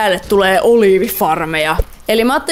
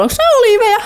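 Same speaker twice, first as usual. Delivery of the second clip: much higher, eager astonishment; high startled question; surprised question; 13 semitones higher, 3 dB louder, much faster than usual. Real, not acted. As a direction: ask it in sudden delight. Finds olive trees.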